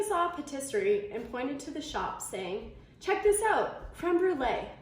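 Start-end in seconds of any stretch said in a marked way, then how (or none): 3.0-4.7 lifted, eager and louder; excited pointing. A narrator's reading. Tamika points out a pastry to Anthony, excited. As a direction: call her friend over to the shop, brightly.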